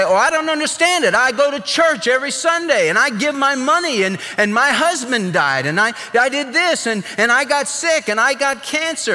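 high, fast, whining churchgoer; preacher mimics a member grumbling about her misfortunes; whiny complainer. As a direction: pitch it up like a self-pitying complainer.

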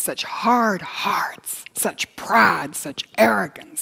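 slow, grieving whisper. Real, not acted. Preacher grieving over hard hearts, slow whisper. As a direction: breathe it out slowly in disbelief.

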